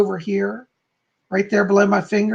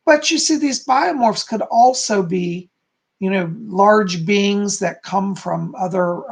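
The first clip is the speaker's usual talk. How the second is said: normal talking voice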